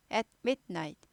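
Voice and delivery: At midnight as three short pieces; staccato at midnight. Shown as a demonstration. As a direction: break after At and after mid in midnight. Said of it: "많이 끊어요".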